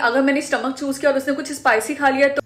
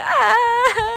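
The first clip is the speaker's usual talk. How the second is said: high mocking laugh at a friend; 7 semitones higher than usual. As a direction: laugh in her face, high and teasing. Mocking the friend's ugly soulmate.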